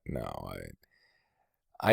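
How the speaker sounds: normal talking voice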